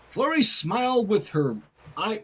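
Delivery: plain reading voice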